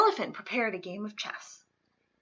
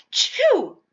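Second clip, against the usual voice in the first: big high sneeze; loud sneeze; 12 semitones higher, 13 dB louder than usual. Acted. Amos sneezes.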